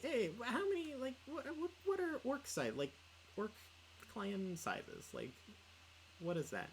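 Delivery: high-pitched eager voice, not quite sure what to ask; eager high questions. Retold or imitated. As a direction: pile on the questions like an excited kid. Retelling her eager questions to DJ.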